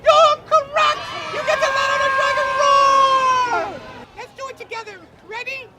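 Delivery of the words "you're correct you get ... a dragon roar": excited shout. Real, not acted. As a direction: cheer the winner loud. Host cheers correct answer.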